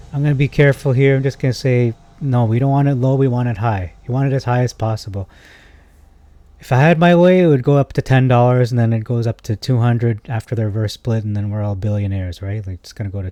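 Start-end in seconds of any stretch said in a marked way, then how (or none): 0.1-5.3 louder, fast, firm correction; emphatic insisting. Real, not acted. Insisting viewers want price high. 6.6-12.4 excited daydream. Real, not acted. Dreaming aloud about the stock soaring, excited.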